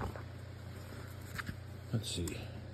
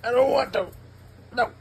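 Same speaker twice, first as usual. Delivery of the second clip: mock whine; 12 semitones higher, 3 dB louder, faster than usual. Real, not acted. Dad whining at his daughter to stop reading, high.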